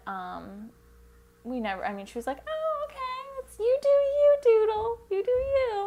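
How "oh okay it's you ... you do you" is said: very high, loud imitation of Mum; mock supportive mum. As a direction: squeal it out as an over-encouraging mum. Retold mother's reaction to coming out.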